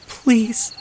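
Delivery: high, cracking plea; cracking, desperate please. Acted. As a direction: beg with a breaking voice. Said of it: "her voice cracked".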